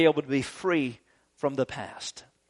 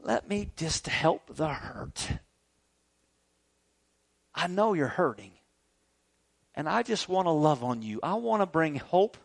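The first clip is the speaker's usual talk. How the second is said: tender God; 2 semitones lower, 7 dB quieter than usual. Retold or imitated. God comforting his hurting child, soft and tender. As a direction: speak like a father cradling a hurting child. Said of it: "He's saying".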